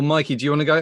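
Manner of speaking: ordinary talk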